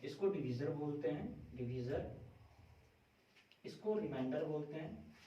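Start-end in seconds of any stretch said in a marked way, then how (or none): none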